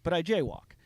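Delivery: ordinary delivery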